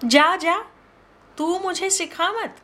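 high, sharp brush-off; a touch of jangling irritation; irritated snap. Acted. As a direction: snap at her daughter to stop lecturing. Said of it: "Vishakha झंकृत होकर बोली".

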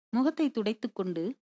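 usual speaking voice

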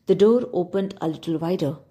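usual speaking voice

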